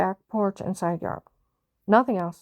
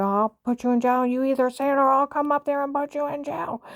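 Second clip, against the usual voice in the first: higher, pushy imitation of a threatening agent; a mild mock cop threat, raised pitch; retold threatening agent; 7 semitones higher than usual. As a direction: mimic the agent laying down an ultimatum.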